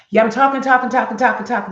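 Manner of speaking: demo of talking. Shown as a demonstration. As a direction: rattle it on loudly before the hush. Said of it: "I started to talk".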